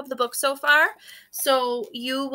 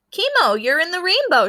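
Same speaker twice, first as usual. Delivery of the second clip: high, loud call across the beach; 8 semitones higher, 6 dB louder than usual. A narrator's reading. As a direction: call out brightly to a friend. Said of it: "she called".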